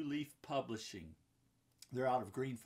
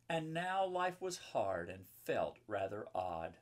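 louder rhyming storybook read; reading to children; 3 semitones higher, 5 dB louder than usual. A narrator's reading. Reading the picture book to the kids watching, louder rhyming read-aloud. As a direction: read it out with a rhythmic lilt.

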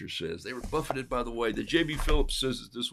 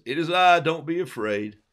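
calling out; 5 semitones higher, 8 dB louder than usual. Retold or imitated. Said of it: "And he says".

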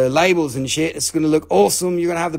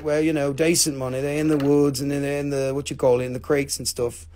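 ordinary talk

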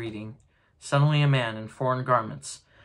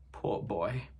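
pitying cry; 3 semitones lower, 6 dB quieter than usual. A narrator's reading. Scrooge pitying his younger self, softly sad. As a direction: lower and softer, full of pity. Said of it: "he cried again".

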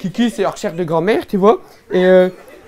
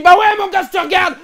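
bragging shout; 8 semitones higher than usual. Pretend. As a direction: yell the comeback with bravado. Delivering his punchline.

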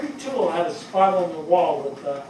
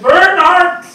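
much higher and louder, a sharp call of the full name; mother calling; 13 semitones higher, 15 dB louder than usual. Retold or imitated. Firefighter voicing Barney's mother calling his name, a high loud call. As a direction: call him in like a stern German mother. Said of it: "his mom always said".

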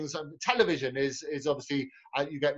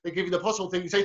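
ordinary talk, nothing marked

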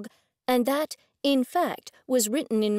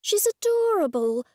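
cooing; 10 semitones higher than usual. Acted. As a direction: coo it to the puppy. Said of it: "I cooed".

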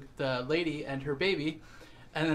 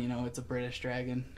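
ordinary talk, nothing marked

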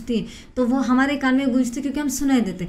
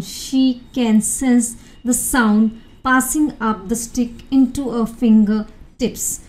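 slow, careful English reading; measured classroom reading of a text; slow reading aloud; much slower than usual. Acted. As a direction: read each English word out slowly for students. Reads English article slowly.

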